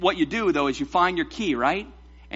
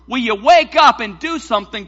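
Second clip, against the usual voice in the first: loud, raised, urgent plea; exasperated demand; 5 semitones higher, 9 dB louder than usual. Acted. Disciples wake Jesus in the storm, exasperated demand. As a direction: demand action at full voice.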